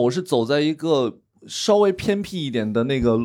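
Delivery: ordinary talk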